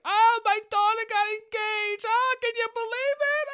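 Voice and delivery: very high squealing aunt voice; imitating aunt. Mimicking his aunt gushing about her daughter, very high. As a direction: gush like a wide-eyed aunt. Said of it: "but she'd be like".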